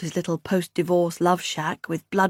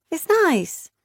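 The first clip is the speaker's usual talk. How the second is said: high, light sister; mildly pleased sister; Beth's voice; 10 semitones higher than usual. A narrator's reading. Beth's quoted remark.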